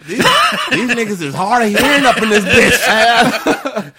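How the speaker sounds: slow, loud, high mock kid; laughing. Dad joining the joke, slow, loud and laughing.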